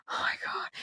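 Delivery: voiceless, whispered mock dread; barely audible awestruck whisper, re-enacted; hushed suspense.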